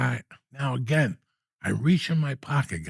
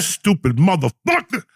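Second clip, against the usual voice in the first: angry; 5 semitones higher, 5 dB louder than usual. Retold or imitated. Imagined telling off a traveller.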